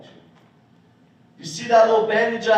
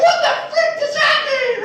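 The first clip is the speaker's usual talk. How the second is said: put-on very high, loud, baffled kid squeal; hammed-up falsetto child impression; prepubescent boy voice; 6 dB louder than usual. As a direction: squeak it as a confused little boy. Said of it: "I had a higher voice".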